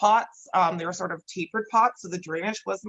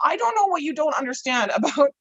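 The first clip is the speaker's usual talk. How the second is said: exasperated retort; 6 semitones higher than usual. Retold or imitated. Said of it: "I'm like".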